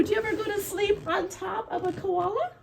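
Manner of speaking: usual speaking voice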